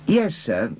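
high-pitched, lightly voiced deferential subordinate; Reader. Reader answers his chief.

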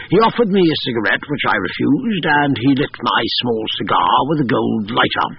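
ordinary delivery

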